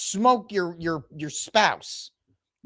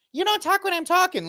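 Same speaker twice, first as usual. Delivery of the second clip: mocking imitation; 13 semitones higher than usual. Mocking his line.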